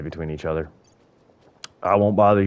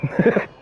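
high unvoiced wheeze of a laugh; airy high giggle; breathless laugh; 12 semitones higher than usual. Real, not acted. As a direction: laugh it off in disbelief. Laughs at his death.

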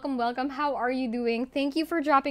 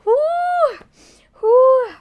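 high, loud "whoo"; excited whoop; 13 semitones higher, 11 dB louder than usual. Real, not acted. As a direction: let out a big whoop to release nerves. Letting out overwhelmed excitement.

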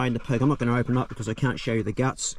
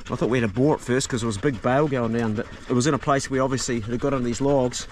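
normal talking voice

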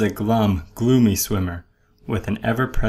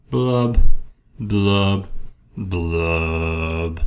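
each blub sinks lower, last one long and deep; glum blubbing; 5 semitones lower, 5 dB louder, much slower than usual. Acted. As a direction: bubble it out, sinking into gloom. Fish's sinking refrain.

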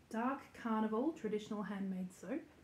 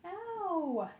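higher yelp at the bite; 9 semitones higher, 4 dB louder than usual. Real, not acted. Puppy nips her again, she yelps high.

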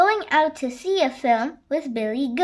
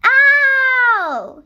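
loud, high howl of pain; long hurt cry from a fallen child; 11 semitones higher, 9 dB louder than usual. A narrator's reading. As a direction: yowl it out, pitched way up. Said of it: "he howled".

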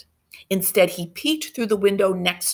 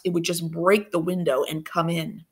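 ordinary delivery, nothing marked

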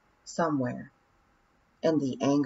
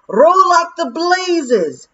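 much higher and louder, slow, drawn-out pixie yell; 13 semitones higher, 12 dB louder, much slower than usual. Acted. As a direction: bellow at the rowers in panic. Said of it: "Rob Anybody yelled".

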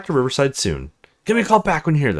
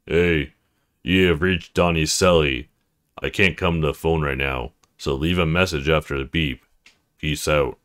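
dropped low voice; 9 semitones lower than usual. Acted. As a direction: put on a low gruff guy voice. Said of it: "drops her voice down low".

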